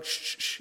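soft voiceless shh, shh, shh; shushing. Retold or imitated. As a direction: hush a fussing baby in church. Moms shushing babies in church.

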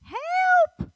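piercingly high, loud holler; yelling. Retold or imitated. Resident yelling in an echoing tiled restroom, loud high yell. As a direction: let the cry ring out, imitating her. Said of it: "And she's yelling".

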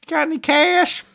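high-pitched put-on character voice; Pino impression. Retelling the movie to co-host, high mock character voice. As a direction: pitch it way up, as a caricature. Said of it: "And Pino's like".

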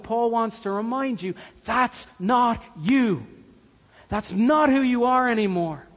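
ordinary talk

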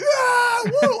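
very high-pitched whooping; mock crowd cheer. Courtroom crowd cheering the verdict, mock whooping. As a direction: whoop like a whole audience cheering. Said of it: "get up and start cheering".

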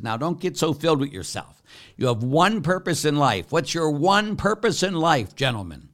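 booming admonition from the pulpit; stern preaching. Retold or imitated. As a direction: put the seminarians in their place, loud and high. Priest lecturing seminarians.

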